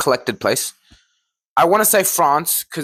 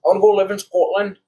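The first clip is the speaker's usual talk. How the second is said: higher-pitched, messed-up Scottish; botched Scottish accent; 5 semitones higher than usual. Pretend. Co-host showing off to the host, botched Scottish accent. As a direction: have a bad go at a Scottish accent. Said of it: "I messed up my accent".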